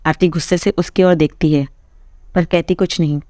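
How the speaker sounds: usual speaking voice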